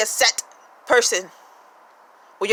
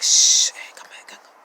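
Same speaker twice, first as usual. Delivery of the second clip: whispering to self. Retold or imitated. Calling yourself back in a whisper. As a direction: hush yourself back gently.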